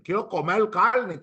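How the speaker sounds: "comer carne" with each r turned to l; dialect pronunciation. Shown as a demonstration. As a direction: say "comer carne" as "comel calne". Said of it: "¿El dialecto fonético qué es? Pronunciación".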